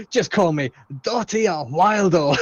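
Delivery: slow, put-on Japanese accent; teasing Subaru in a mock Japanese accent. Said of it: "my mildly racist Japanese accent".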